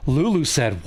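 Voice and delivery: plain reading voice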